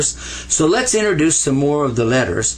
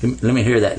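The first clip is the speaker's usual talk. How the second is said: ordinary talk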